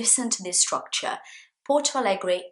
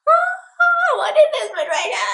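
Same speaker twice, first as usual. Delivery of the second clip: shrill panicked squeal, much higher and louder; mock freak-out; 7 dB louder than usual. Shown as a demonstration. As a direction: play a hammed-up panic with a laugh. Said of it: "Freak out is like".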